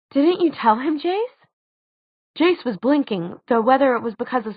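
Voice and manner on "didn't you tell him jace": high, rising incredulous question; amazed. A narrator's reading. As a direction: ask in amazement, pitch climbing to the name.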